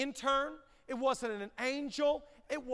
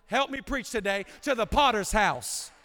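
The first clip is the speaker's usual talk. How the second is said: full-force preacher's shout; preaching shout; 9 dB louder than usual. Real, not acted. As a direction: belt it out to the church.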